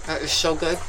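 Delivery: talking with a mouthful; mouth full. Real, not acted. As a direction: say it through a bite of bread. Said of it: "Sorry for talking with my mouth full".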